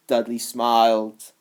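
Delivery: ordinary delivery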